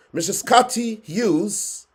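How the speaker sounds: ordinary talk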